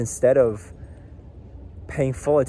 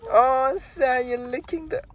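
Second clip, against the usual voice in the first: high pet voice; 13 semitones higher, slower than usual. Real, not acted. Scolding the dog fondly.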